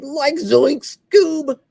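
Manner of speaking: very high, slow zany stoner voice; Shaggy. Retold or imitated. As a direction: stretch it out like a goofy cartoon slacker. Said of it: "I can do Shaggy and Scooby".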